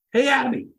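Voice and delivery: high, loud call across the room. Retold or imitated. Ty calling Abby over across the room, loud high call. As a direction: sing out her name to get her attention.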